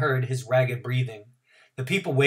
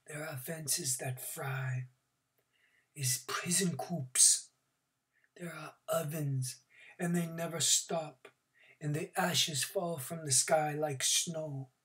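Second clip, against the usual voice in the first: haunting whisper; 4 semitones higher, 9 dB quieter, slower than usual. A narrator's reading. Old man describes the camp.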